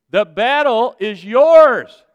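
loud, drawn-out preaching cry; emphatic proclamation. Real, not acted. Preacher declaring God's promise to congregation, emphatic proclamation. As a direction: proclaim it slowly at full voice.

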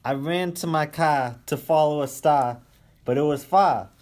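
Boston accent. Shown as a demonstration. Guest proving he's from Boston to the hosts, in a Boston accent. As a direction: read it out loud, dropping your Rs like a Bostonian. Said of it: "I want Rossi to read it to prove that he's from Boston".

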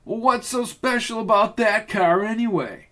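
a high, anxious junkyard hand; whiny henchman. Acted. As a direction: play the put-upon lackey. Roy questioning Rizzo.

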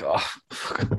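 low, barely voiced grumble; weary Santa. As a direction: breathe it out low and soft, fed up. Acting out an unenthusiastic Santa.

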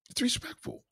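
way up high, soft and dainty; high thin mock voice. Shown as a demonstration. Streamer mimics a lady's reply in falsetto. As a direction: chirp it in a falsetto.

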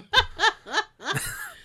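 shrieking laugh; high giddy laugh. Real, not acted. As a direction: squeal of laughter. Giddy laugh after fan gush.

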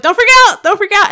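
high, loud, punched panic; half-laughing yelp of alarm, retold; panicked plea. Telling friends how the bird came straight at her, panicked.

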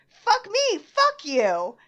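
high, loud retort, played up; acted shouted comeback. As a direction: shout it right back, reenacting a bar fight. Acting out her comeback shout.

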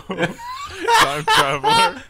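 laughing. Real, not acted. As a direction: squeal it out through laughter. Laughing at friend's joke.

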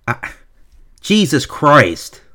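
usual speaking voice

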